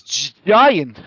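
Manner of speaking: stammering, high and loud alarm; startled. Acted. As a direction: shout it, startled by a giant. Woman startled by giant.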